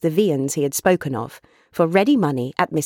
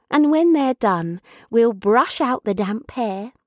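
put-on higher sing-song comforting of a child, stretched words; Meg, busily cheering; 4 semitones higher than usual. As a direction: fuss cheerfully over a little one.

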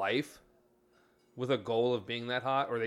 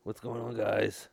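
raspy deep voice; 2 semitones lower, slower than usual. Retold or imitated. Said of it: "like that raspy, deep".

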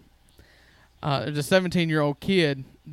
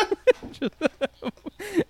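high squeaky laughter. Real, not acted. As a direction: laugh rising high and breathless. Retelling the turkey slapping.

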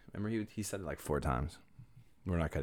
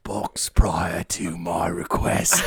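mock English accent; 3 semitones lower, much slower than usual. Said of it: "English accent or an F D R accent".

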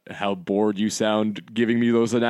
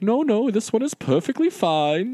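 higher, prim put-on voice brushing off the complaint; imitating Crawley; 11 semitones higher than usual. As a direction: play the stubborn tea-bag regular. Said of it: "He's like".

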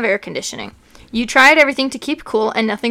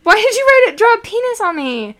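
surprised; 8 semitones higher than usual. Real, not acted. Sees a drawing on her.

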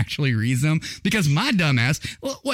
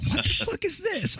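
much higher, softer disbelieving cry; baffled outburst; 5 dB quieter than usual. Retold or imitated. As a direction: squeak it out in sudden disbelief. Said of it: "And I'm like".

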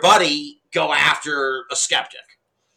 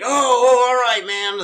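high, louder oh oh, then easing off; sudden realization; 10 semitones higher, 5 dB louder than usual. Retold or imitated. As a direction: caught out and backing down. Guy realizes misunderstanding.

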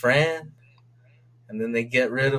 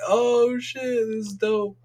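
much higher excited quote; retold excitement; 10 semitones higher, slower than usual. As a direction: relive the hype moment. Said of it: "And I'm like".

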